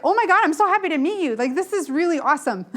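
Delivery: gushing happily at a new person; excited greeting. Retold or imitated. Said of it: "I love meeting people".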